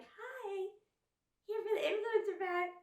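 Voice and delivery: very high, airheaded influencer greeting; dumb influencer voice. Retold or imitated. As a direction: play up a ditzy influencer. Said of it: "a little more dumb".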